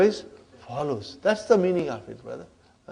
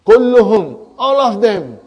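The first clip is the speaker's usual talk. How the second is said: "Kulluhum, all of them" punched out loud and higher; emphatic; 3 semitones higher, 8 dB louder than usual. Shown as a demonstration. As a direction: hit "Kulluhum" hard, then "all of them". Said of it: "Now I want to emphasize".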